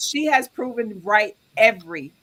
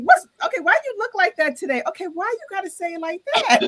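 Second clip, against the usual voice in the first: very high-pitched mock offence; squeaky-high playful protest; imitated indignant complaint; 12 semitones higher, 4 dB louder, faster than usual. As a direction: mimic someone affronted, pitched way up. Imitates people's touchy reactions.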